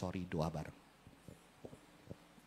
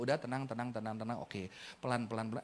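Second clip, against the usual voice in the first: soothing calm; 8 semitones lower, 10 dB quieter than usual. Shown as a demonstration. Parent calming a child, soft and low. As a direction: ease the child down gently. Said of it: "Papanya kan stres".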